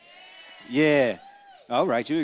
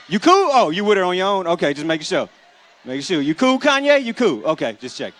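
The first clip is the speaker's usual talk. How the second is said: higher, louder call; loud check-in; 3 semitones higher, 5 dB louder than usual. Retold or imitated. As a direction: call out to check he's okay. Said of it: "I'ma take your picture".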